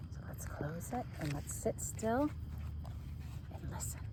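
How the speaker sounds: hushed whisper to the class. Real, not acted. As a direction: whisper so they can listen. Asking class to sit still.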